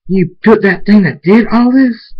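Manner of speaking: high, loud outraged question; shouted accusation. Acted. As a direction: yell it in disbelief and blame. Cadance confronts Armor in accusation.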